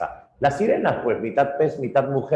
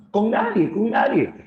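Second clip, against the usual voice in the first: panicked cry; 12 semitones higher than usual. Acted. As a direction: cry out to his brothers in panic. Cyclops insists to brothers. (el cíclope herido pide ayuda a sus hermanos, gritando agudo)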